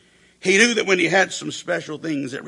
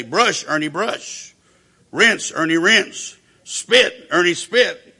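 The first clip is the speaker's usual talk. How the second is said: put-on high, loud sing-song little boy instructions; bossy kid teaching his doll, rising on each command; boy bossing his Ernie doll; child coaching doll; 4 semitones higher, 5 dB louder, slower than usual.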